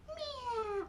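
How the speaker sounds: high, soft puppet meow; tiny kitty mew in a character voice; Mimi. Acted. Mimi does the cat sound, high soft mew. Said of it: "Sometimes I forget if I'm Mimi or Ellen".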